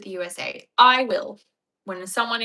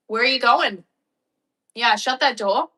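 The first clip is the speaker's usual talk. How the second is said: loud, raised call across the house; 5 semitones higher, 10 dB louder than usual. Real, not acted. Owner catching pet at forbidden room, loud raised call. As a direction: call after a wandering pet, louder and higher.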